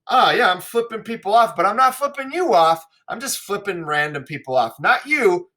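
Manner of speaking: high, sing-song fake innocence; mock innocent. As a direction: pretend you're not flipping him off. Mocking paraphrase of the servant.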